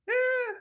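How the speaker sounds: high, skeptical drawn-out "Eh"; a doubting "Eh" pitched far up; doubtful eh. Retold or imitated. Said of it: "whenever you get that sound".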